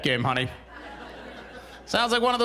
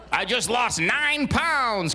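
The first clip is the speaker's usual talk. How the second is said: mock proud poster; 5 semitones higher, much slower than usual. Mocking a Facebook post.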